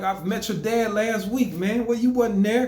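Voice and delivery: high, animated quoted talk; lively retold chat. Telling the boy about his dad, high and lively. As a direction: play it up as you retell. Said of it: "I was like".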